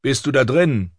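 calling out. A narrator's reading. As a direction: check on the girl from outside. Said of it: "rief er".

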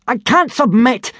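high, indignant squawk. A narrator's reading. Boy hero refuses to give in, indignant. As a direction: protest in an outraged high voice. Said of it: "said Hiccup indignantly".

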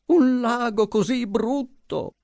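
put-on high, slow, thin old voice; frightened lament. A narrator's reading. Scared old wife in the boat, frightened lament. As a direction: moan about the ugly lake.

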